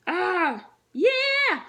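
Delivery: squealed high and loud in a put-on voice; gleeful cheer. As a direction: whoop like a giddy kid. Voicing a gleeful sibling.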